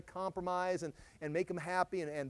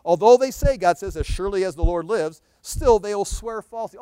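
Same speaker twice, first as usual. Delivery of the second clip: fiery preaching; 2 semitones higher, 13 dB louder than usual. Acted. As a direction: thunder the prophet's lament at them. Preaching scripture.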